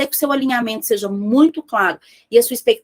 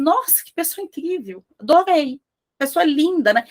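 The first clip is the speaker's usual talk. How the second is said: gushing admiration; 5 semitones higher than usual. Retold or imitated. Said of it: "alguém que fala assim".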